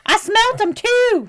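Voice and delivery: high, loud, drawn-out yokel outburst; excited mock witness. Joking as a Florida Sasquatch witness, high comic shout. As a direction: cry it out slowly in a high put-on voice.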